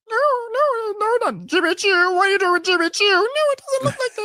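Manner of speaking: very high, squeaky mock protest; [name]. [name] objecting to the shoes, mock high-pitched protest. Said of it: "I'm just in my high-pitched voice".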